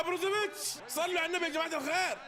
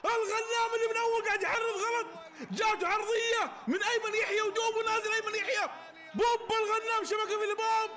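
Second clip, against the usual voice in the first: real ecstatic goal shout; goal call; 5 semitones higher than usual. Real, not acted.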